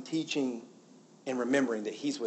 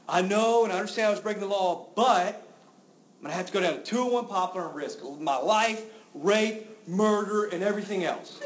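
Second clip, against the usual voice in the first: mock angry complaint; 6 semitones higher, 6 dB louder than usual. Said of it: "this person was really mad".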